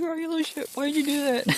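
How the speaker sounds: high, playful telling-off; joyful, teasing cussing with laughter behind it; endearing mock scolding. Shown as a demonstration. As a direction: scold fondly in a squeaky-high pitch. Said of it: "said endearing".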